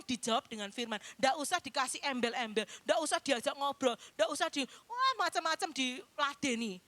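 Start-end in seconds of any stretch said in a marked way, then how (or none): none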